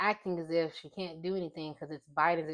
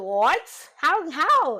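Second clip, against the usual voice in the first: loud, high "What? How, how?" in retold shock; shocked disbelief; 8 semitones higher, 10 dB louder, much slower than usual. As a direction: throw it out as if you can't believe your ears. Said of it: "I was like".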